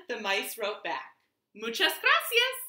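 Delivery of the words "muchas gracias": squeaky mouse. Acted. Mice voiced writing back.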